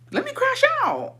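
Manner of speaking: sing-song high-pitched demand; mock defiant cry. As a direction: whine it out way up high, hammed up. Mock defiant outburst in a retelling.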